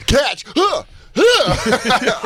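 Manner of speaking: mock bully taunt. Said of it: "And he goes".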